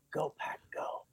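hushed, whispered team chant; very quiet. Retold or imitated. Businessman testing a stranger in Tokyo, very quietly. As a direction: whisper it as a tentative test. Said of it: "he very quietly said".

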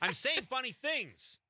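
high, drawn-out mock indignation; mock protest. Real, not acted. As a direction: protest slowly in a high, playful voice. Mock protest at co-host's laughter.

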